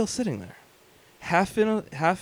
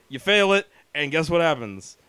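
ordinary talk

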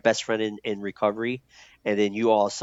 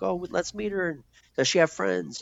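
friend asking; 10 semitones higher than usual. Retold or imitated. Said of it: "He's like".

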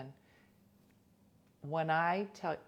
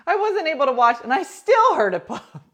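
high, loud excited exclaiming; 11 semitones higher, 16 dB louder than usual. Real, not acted. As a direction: burst out excitedly.

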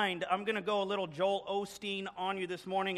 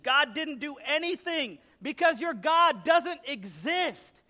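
high, slow, emphatic yell; acted fed-up shouting; exasperated scream; 7 semitones higher, 4 dB louder, slower than usual. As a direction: hammer each word in exasperation. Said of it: "the atheist screamed".

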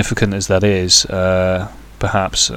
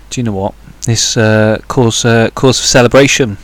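excited toast; 2 semitones higher, 7 dB louder than usual. Retold or imitated. Father voiced, celebrating the newspaper.

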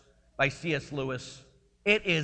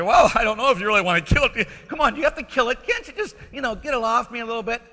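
louder, fast, higher exasperated bargaining; frustrated pleading; 7 semitones higher, 6 dB louder, faster than usual. Retold or imitated. Man bargains with the angel, fast and frustrated. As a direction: push back, annoyed, pleading to keep it. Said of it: "the man starts getting frustrated".

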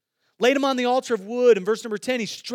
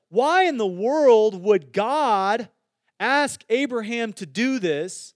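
slow emphatic question; 3 dB louder, slower than usual. Real, not acted. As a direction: drag out why in the world, louder. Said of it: "preacher's emphatic slow question".

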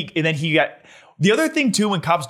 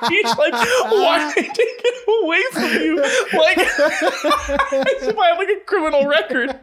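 much higher, giggling through the story; can barely get words out for laughing; slower than usual. Real, not acted. Laughing retelling license question.

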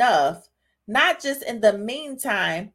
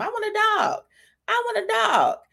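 put-on whiny kid begging; 10 semitones higher than usual. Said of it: "when they be talking about some".

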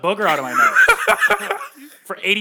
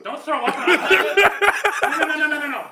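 laughing protest; 10 semitones higher, slower than usual. Real, not acted. Friend about to lick his nose.

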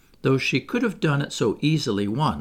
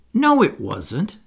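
slow, firm, grave contradiction; louder, drawn-out solemn correction; 5 semitones higher, 5 dB louder, slower than usual. A narrator's reading. Jane corrects the imitation of Peter's crow, gravely. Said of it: "Jane said gravelly".

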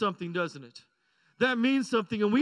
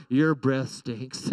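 much lower, very slow and deadpan; blunt kid's verdict; 11 semitones lower, much slower than usual. Retold or imitated. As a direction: drag it out flatly like an unimpressed child. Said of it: "and her response".

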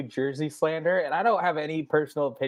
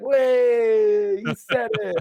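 long, high crowd cheer; 7 semitones higher, 6 dB louder, much slower than usual. Retold or imitated. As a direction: imitate a crowd popping, loud and drawn out. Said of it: "the crowd popped for it, they were like".

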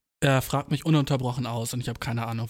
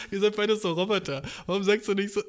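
laughing; 7 semitones higher than usual. Real, not acted. Laughing at the couple.

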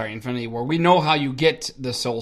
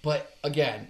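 ordinary talk, nothing marked

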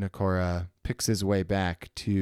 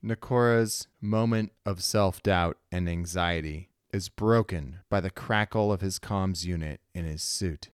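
ordinary delivery

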